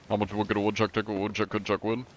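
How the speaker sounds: rattled off fast; tongue twister showing off speed. Shown as a demonstration. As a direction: race through the tongue twister. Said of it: "crazy fucking tongue twisters without fail".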